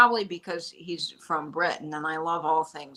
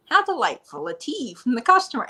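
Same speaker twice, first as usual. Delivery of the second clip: lightly imitated British accent, delighted and a little higher; a hint of a cheerful Londoner, pleased with a tea; delighted mock British accent; 6 semitones higher, 3 dB louder than usual. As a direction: play the chipper London pool cleaner, only lightly British. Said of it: "he says things in the most delightful voice".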